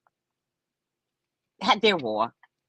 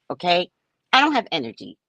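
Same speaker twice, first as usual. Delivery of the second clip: ordinary talk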